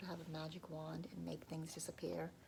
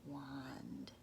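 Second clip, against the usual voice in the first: whispered narration. A narrator's reading. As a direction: whisper to draw children in.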